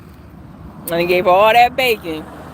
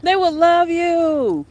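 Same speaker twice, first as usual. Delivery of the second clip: much higher, slow, coaxing tone; pleading with grandma; 9 semitones higher, slower than usual. Retold or imitated. Begging grandma to come on YouTube, high and drawn out. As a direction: plead sweetly, stretching every word up high. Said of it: "And I'm like".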